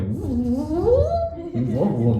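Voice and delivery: high mimicked rewinding tape; high vocal tape-machine noise; imitated tape whir. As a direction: make the whirring sound of tape spooling. Imitates tape being wound.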